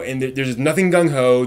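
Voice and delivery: normal talking voice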